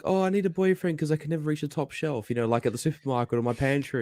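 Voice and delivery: high, mocking girly voice; high mock damsel voice; mocking girl's joke. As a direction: parody a helpless girl. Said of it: "make the joke of".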